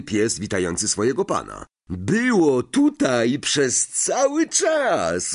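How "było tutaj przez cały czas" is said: higher, slow, delighted; a boy happily reunited with the family car; overjoyed call. Acted. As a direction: call out with glad surprise. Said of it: "zawołał uradowany Ron".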